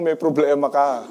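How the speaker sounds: ordinary talk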